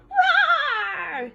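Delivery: high, stretched kitten roar; a cub trying to sound fierce; little puma's growl-roar. Acted. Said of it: "he growled".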